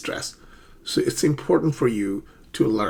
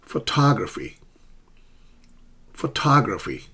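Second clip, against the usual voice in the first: "Photography" as pho-TOG-raphy; careful model of pho-TOG-raphy; stress on second syllable; 2 semitones higher than usual. Shown as a demonstration. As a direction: lean on TOG in "Photography", then fall. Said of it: "not photography".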